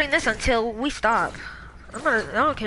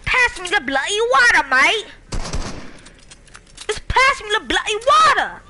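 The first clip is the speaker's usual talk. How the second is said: louder, higher fake British accent; mock British shout; 9 semitones higher, 5 dB louder than usual. Yelling at teammates for water in a mock British accent. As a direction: yell it like an angry Brit. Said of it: "I'm British".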